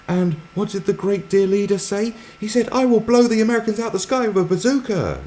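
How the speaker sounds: very high, loud mock classroom chant; shrieked caricature of a propaganda lesson; fervent schoolteacher impression. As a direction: imitate indoctrinated zeal at full pitch. Imitates North Korean school lesson.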